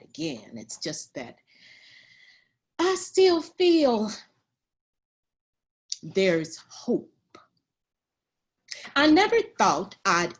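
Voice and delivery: put-on animated elderly storyteller, swinging between hushed and emphatic; very old woman, Pearl. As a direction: reminisce as a spirited centenarian, with long pauses. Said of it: "a hundred and ten-year-old woman".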